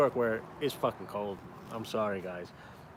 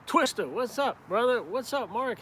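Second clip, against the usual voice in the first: high, bright shout-out to a viewer; excited live shout-out to a viewer; excited greeting; 10 semitones higher than usual. Real, not acted.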